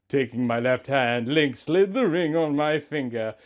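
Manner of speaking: ordinary delivery, nothing marked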